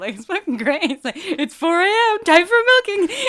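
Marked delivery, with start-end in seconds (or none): 1.2-3.2 high, loud call through laughter; mock wake-up yell. Host mimics a film character announcing milking time, mock wake-up yell. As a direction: bellow a gleeful, hammed-up wake-up call.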